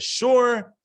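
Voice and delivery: high, loud cheerful sure; cheerful bartender. Retold or imitated. Bartender agrees to the second round, high and cheerful. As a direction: agree brightly to the order. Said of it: "the bartender says".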